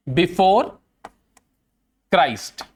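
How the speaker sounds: long gap between words, pitch dropping on the last; word-by-word dictation. Real, not acted. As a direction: spell it out word by word for the class. शब्द-शब्द लिखवाते हुए दोहराना. (शब्दों के बीच रुककर लिखवाते हुए)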